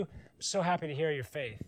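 usual speaking voice

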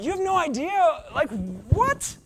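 sudden high squeak of disbelief; can't believe what he's hearing, pitch leaping up; incredulous outburst. Retold or imitated. Jesus baffled by the world's advice, high and incredulous.

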